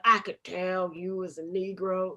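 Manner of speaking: imitating a rural American bigot. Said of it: "like, redneck voice".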